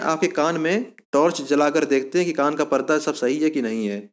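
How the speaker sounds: ordinary talk, nothing marked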